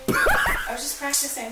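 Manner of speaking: laughing. Real, not acted. Caught, laughing it off.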